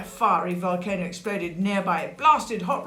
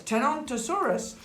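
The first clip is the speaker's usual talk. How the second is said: "Tenontosaurus" said higher, at normal pace; triumphant repeat; 7 semitones higher than usual. Shown as a demonstration. Storyteller repeating the dinosaur's name, "Tenontosaurus" high and unhesitating. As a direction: say "Tenontosaurus" in one confident go.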